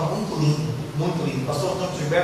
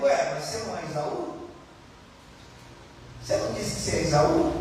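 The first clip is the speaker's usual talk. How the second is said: inner voice; 4 semitones lower, 8 dB quieter, faster than usual. Retold or imitated. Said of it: "O interior dizendo".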